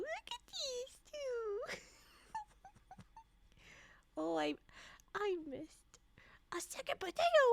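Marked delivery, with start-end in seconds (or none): 0.0-1.8 soft, slow, higher cooing; doting slow coo. Shown as a demonstration. 5.1-7.4 stage whisper. Acted.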